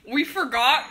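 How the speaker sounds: giggling through the words; laughing. Real, not acted. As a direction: laugh while saying it. Laughing at the failed cake.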